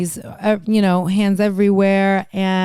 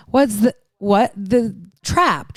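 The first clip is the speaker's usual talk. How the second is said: usual speaking voice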